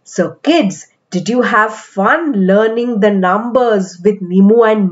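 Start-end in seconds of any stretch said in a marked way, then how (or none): none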